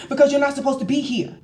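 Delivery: hurried mock telling-off; imitated stern retort. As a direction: act out an official snapping back. Officer's retort acted out.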